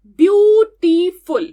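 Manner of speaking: ब्यूटीफुल in three loud, slow beats, ब्यू, टी, फुल; syllable by syllable. Shown as a demonstration. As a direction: sound out ब्यूटीफुल loudly in three separate parts. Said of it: "three syllables".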